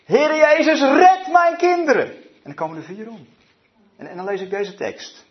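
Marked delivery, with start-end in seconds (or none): 0.0-2.1 loud, high, drawn-out cry for help; desperate scream. Retold or imitated. Mother in Kampen on the street begs Jesus, desperate scream. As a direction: scream out a desperate plea to heaven.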